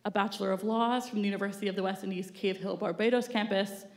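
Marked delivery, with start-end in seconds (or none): none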